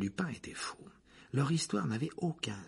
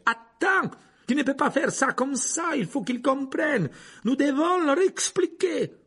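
loud, high-pitched urgent pleading; desperate yelling; 7 dB louder than usual. A narrator's reading. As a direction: shout to restrain him, voice way up.

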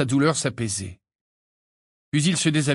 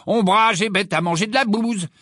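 high, loud proclamation; thunderous, high-pitched heckle; booming voice; 8 semitones higher, 4 dB louder than usual. A narrator's reading. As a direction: blare the insult out like a talking poster. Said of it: "d'une voix tonitruante".

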